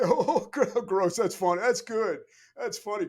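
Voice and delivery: high, loud burst of mock amusement; imitated laughing. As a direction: play an upperclassman suddenly tickled by a name tag. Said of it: "they'd laugh and walk away".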